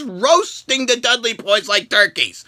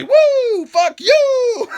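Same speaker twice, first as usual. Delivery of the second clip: Ric Flair woo; 14 semitones higher, much slower than usual. Retold or imitated. Ric Flair cursing at Shane, high laughing woo. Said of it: "what he wanted to say".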